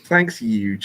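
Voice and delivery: ordinary talk, nothing marked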